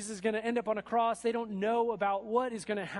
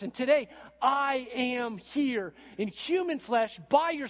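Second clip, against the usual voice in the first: loud, high, driving preacher's climax; full-force preaching; 5 semitones higher, 6 dB louder than usual. Acted. Preacher drives home that Jesus is God, full-force preaching. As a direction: preach it out to the congregation.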